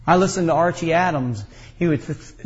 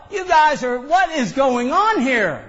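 imagined yell; 9 semitones higher, slower than usual. Retold or imitated. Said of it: "I wanted to yell".

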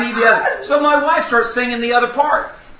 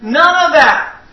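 slow, loud preacher cry of no more pain; preaching at full force; 4 semitones higher, 5 dB louder, much slower than usual. Acted. Preacher promising glory to the church, slow and loud. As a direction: stretch each word out and lift it high.

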